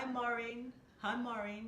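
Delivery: mum's Bajan accent, lightly imitated; a mild take on mum's Barbadian greeting. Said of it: "she'd say".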